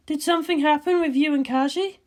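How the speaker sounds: a cool scientist ribbing her friend; Ritsuko's voice. Acted.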